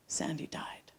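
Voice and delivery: whispered, voice gone; stunned whisper. Real, not acted. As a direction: barely breathe the news out. Telling husband of the death.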